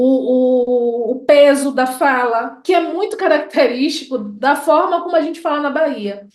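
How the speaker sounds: ordinary talk, nothing marked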